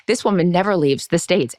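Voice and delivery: normal talking voice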